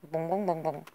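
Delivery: low booming play noise; deep bong sounds. Pretend. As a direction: boom it out low like a bell. Playful noise, much lower.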